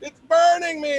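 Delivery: high, loud panicked protest; panicked high yell. Retold or imitated. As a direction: cry out, high and frantic. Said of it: "she's like".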